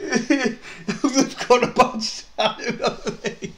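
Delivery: high, slow echo through laughter; laughing mimicry. Co-host echoes the tattoo joke, laughing high. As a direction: repeat the mockery, helpless with laughing.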